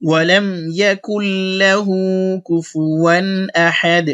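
slow, melodic Quran recitation; tajweed recital, drawn out; slow full recitation. Shown as a demonstration. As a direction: recite the whole verse so they follow. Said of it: "আমরা একটা সম্পূর্ণ একসাথে বলি".

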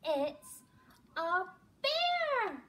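high loud alarmed shout; frightened cry. Acted. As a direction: cry out in mock terror. The bear is discovered.

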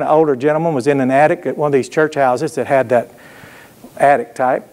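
ordinary talk

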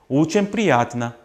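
오첸 stretched long, the stressed vowel held; lengthened stress. Shown as a demonstration. Teacher shows students to lengthen the stressed syllable. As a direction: drag out the 오 in 오첸 slowly. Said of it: "일부러 좀 길게 해주시면".

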